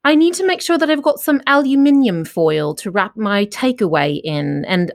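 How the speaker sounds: British accent. Shown as a demonstration. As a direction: play a Coronation Street Brit musing at home. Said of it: "I talk to myself in a British accent".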